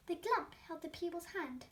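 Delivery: ordinary delivery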